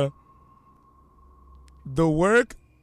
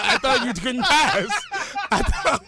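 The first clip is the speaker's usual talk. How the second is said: high-pitched, laughing protest; flustered protest; 8 semitones higher than usual. Real, not acted. Guest to hosts, thought he could pass, flustered high protest.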